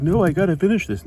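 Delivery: high, loud whiny protest; mock protest. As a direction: cry out like a kid pulled away from his toys. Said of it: "I'd be like".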